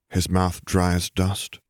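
usual speaking voice

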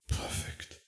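breathed out under the breath; whisper. A narrator's reading. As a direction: whisper it, barely there. Tearful, intimate moment, breathed words.

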